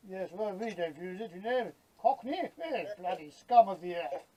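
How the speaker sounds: a hint of a sneering Knightsbridge type; lightly voiced snooty West Londoner looking down on Cockneys; mock Chelsea snob. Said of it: "Probably somewhere like Chelsea".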